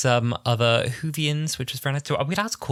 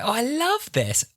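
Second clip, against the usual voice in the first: high, drawn-out gush; gushing star; slower than usual. Retold or imitated. Retelling [name] admiring the getup, gushing. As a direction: imitate a star gushing slowly. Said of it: "she's like".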